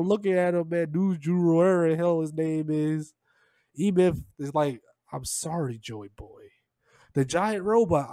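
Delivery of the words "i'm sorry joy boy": low, soft acted regret; quiet, low mock remorse; sorrowful apology. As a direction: voice a hushed apology, sinking at the end. Voicing the giant's sorrowful apology.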